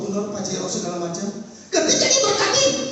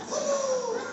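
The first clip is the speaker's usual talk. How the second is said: much higher, soft gasp of dismay; 13 semitones higher, 9 dB quieter than usual. Real, not acted. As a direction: caught off guard. Pastor dismayed by English request. (kaget dan cemas, tinggi dan pelan)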